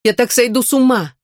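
high, scared outburst; frightened cry. Acted. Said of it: "закричала она испуганно".

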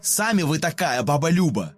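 shouting back. Acted. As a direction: yell it right back at her. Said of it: "закричала ей в ответ".

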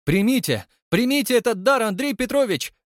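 very high, loud urging cry; crowd shouting. A narrator's reading. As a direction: call out from the hall, urging him. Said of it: "закричали ему из зала". (громкий крик из зала, очень высоко)

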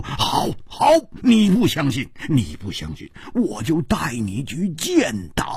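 plain reading voice

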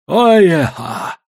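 ordinary delivery

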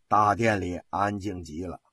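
usual speaking voice

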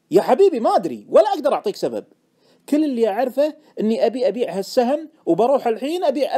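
high, loud protest; exasperated insistence. Acted. Trader defending an unexplained hunch to his friend, exasperated. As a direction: insist loudly that you have no reason.

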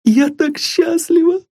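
very high, bursting with happiness; a girl who can hardly believe her luck; high joyful girlish cry; overjoyed young girl. A narrator's reading. Sylvia overjoyed.

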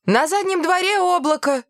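put-on high-pitched excited little girl; excited exclamation. A narrator's reading. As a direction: exclaim like an amazed schoolgirl. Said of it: "воскликнула Далия".

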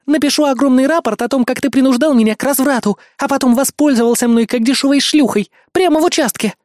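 high, heated threatening rant; spiteful threat. A narrator's reading. Scornful woman threatens false report.

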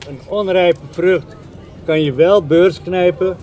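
measured lyric reading with spaced phrases; poem recitation. Acted. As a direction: linger on the opening words, then pause between phrases. Recites a translated song lyric.

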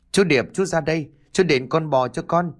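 high-pitched accusing call; mildly heated demand for repayment; angry demand. A narrator's reading. Girl confronts neighbour over her dead dog, angry demand.

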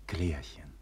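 soft breathy name; tender whisper. Acted. Egmont turns to Klärchen and whispers her name.

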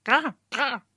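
disapproving bird squawk; a scolding crow cry; bird caw. Acted. The bird scolds Dimitri, squawking caw. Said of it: "kijkt afkeurend".

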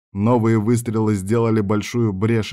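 usual speaking voice

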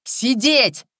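sharp, high, loud order; shouted command. A narrator's reading. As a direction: shout it to stop someone rising. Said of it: "остановил крик Капицы".

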